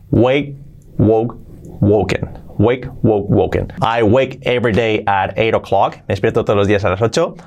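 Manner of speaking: usual speaking voice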